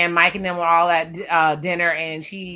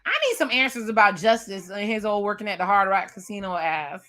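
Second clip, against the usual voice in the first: ordinary delivery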